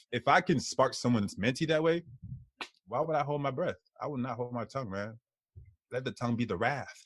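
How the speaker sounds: ordinary talk, nothing marked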